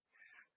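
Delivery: "S" as a soft unvoiced hiss; phoneme sound. Shown as a demonstration. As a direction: hold the bare sound "S" like a hiss. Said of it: "esse som do s". ("S" só o chiado, sem vogal)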